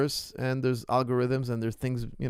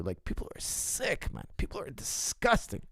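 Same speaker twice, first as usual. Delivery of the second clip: disgusted; 2 semitones higher, 7 dB quieter than usual. Real, not acted. Disgust at paid liars.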